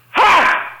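loud, high fake sneeze burst; sudden explosive mock sneeze; demonstrated sneeze. Shown as a demonstration. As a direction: sneeze out a sharp "Ha!". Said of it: "it's a sneeze like this".

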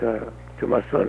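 ordinary talk

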